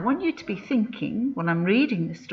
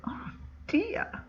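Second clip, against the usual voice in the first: a mock-horrified whispered aside; 13 semitones higher, 9 dB quieter than usual. Real, not acted. Reader's shocked aside to children.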